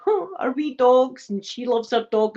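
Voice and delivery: ordinary talk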